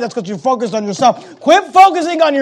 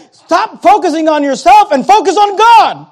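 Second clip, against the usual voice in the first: higher, louder preaching shout; loud rebuke; 9 semitones higher, 4 dB louder than usual. Acted. Gabriel rebuking Zacharias for doubting, shouting. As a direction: thunder it at a doubter.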